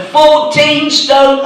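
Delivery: high, loud and very slow announcement; announced weight. Retold or imitated. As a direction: boom the number out for the whole room. Weigh-in staff call out weight.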